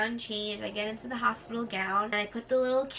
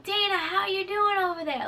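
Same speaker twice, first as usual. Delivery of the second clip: high, raised sing-out check-in; calling over; 9 semitones higher, 4 dB louder than usual. Retold or imitated. Nurses to patient from the other side, calling over. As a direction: call across the room in a high voice.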